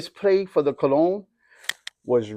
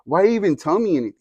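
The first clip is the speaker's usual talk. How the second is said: higher, lightly voiced indignant questioning; little kid; 6 semitones higher, 3 dB louder than usual. Little son questioning dad's mixed messages, lightly voiced kid. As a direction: protest like a baffled child, a touch louder. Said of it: "this is me as a little kid".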